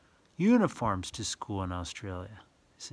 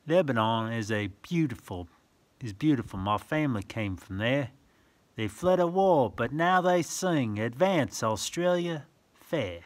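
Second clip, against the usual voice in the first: sing-song rhyming read-aloud; reading verse to children; 5 dB louder than usual. Acted. Reading a picture book to a child, sing-song. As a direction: lilt the rhyme for little listeners.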